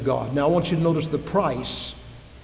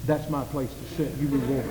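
softer, grumbling possessive churchgoer; grumpy pew owner; 4 semitones lower, 9 dB quieter than usual. Retold or imitated. Churchgoer shooing a visitor from his pew, soft and grumbling. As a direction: mutter it like someone guarding their pew.

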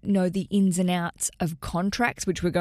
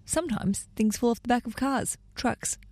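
usual speaking voice

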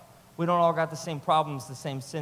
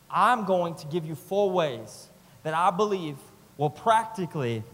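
usual speaking voice

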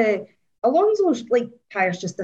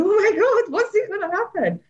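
panicked; 10 semitones higher than usual. Retold or imitated. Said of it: "it was me on the F I A going".

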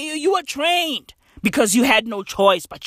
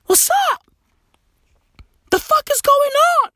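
mock squeak.

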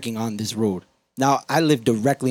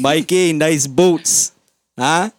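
loud, raised workman's razzing; construction worker; 5 semitones higher, 6 dB louder, much slower than usual. Retold or imitated. Construction worker razzing a mate on site, loud mock holler. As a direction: holler teasing banter at a buddy. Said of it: "hear the construction workers talk".

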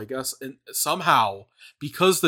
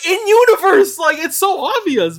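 high, loud disbelieving cry; incredulous outburst; 8 dB louder than usual. Real, not acted. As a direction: exclaim it, baffled. Incredulous about Anakin.

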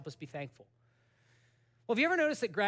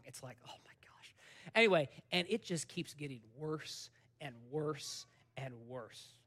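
soft, breathless whisper of mock despair; the preacher groans to the crowd about the endless book, in a mock despairing whisper; 5 semitones lower, 5 dB quieter than usual. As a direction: whisper it like the book is crushing you. Mock despair over long book.

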